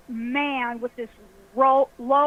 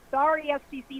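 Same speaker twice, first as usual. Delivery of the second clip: ordinary talk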